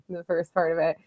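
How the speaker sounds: ordinary talk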